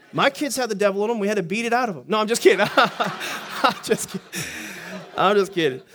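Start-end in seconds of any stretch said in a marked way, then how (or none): 2.1-5.8 laughing through the words; real laughing. Real, not acted. Preacher to congregation after joking about his kids, laughing. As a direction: break up laughing while taking back a joke.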